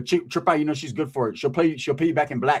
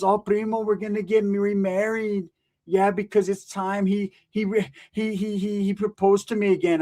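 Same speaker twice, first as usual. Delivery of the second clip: dreamy tia; 5 semitones higher than usual. Retold or imitated. Tia voiced, slow and dreamy.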